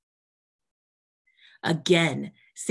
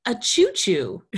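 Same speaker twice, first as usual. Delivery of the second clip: louder, slower, amused; playful punchline; 2 semitones higher, 6 dB louder, slower than usual. Acted. Mother Spider teasing Sammy about the toy train. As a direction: land the joke gently.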